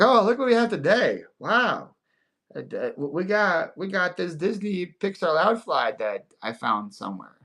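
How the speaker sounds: high-pitched imitation of a seller; mock show host. Imitating friend showing off his finds to viewers, mock surprise. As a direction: feign surprise at your own haul. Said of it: "He's like".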